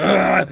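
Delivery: high, very loud imitated roar; lion-like roar. Dad having a heart attack, roaring like a lion. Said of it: "he's roaring like a lion".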